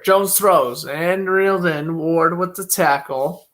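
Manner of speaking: brisk broadcaster's call of each play; live play-by-play. Acted. Calling the football game live for stream viewers, play-by-play announcer voice. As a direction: call the snap and throw like a TV announcer.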